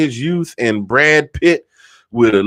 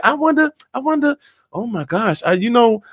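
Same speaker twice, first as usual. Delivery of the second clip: put-on high, excited girlfriend chatter; much higher, giddy voice; gossipy women; 5 semitones higher than usual. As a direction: gush like a delighted church lady. Women voiced in heaven joke.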